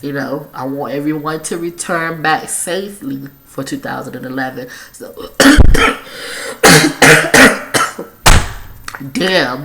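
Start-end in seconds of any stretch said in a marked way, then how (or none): 5.2-8.0 harsh hacking smoker's cough; loud, rough coughing fit. Real, not acted. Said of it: "that's a smoker's cough right there".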